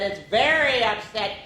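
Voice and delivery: ordinary delivery, nothing marked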